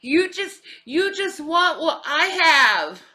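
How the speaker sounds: high, loud, jealous taunt; catty diva. Retold or imitated. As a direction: sneer it up high like a spoiled diva. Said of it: "That's so Effie".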